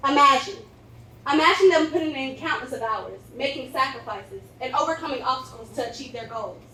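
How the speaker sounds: loud, dramatic courtroom oratory; sway the jury with each imagine; grand rich voice. Acted. Formal opening statement to jury.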